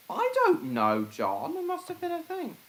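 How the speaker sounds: high put-on voice, teasing; squeaky mock of someone. High mocking voice.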